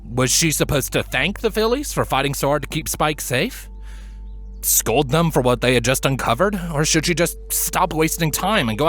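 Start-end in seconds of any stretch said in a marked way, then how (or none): none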